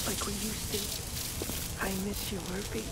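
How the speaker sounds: wistful. Acted. Misses absent friend, much softer.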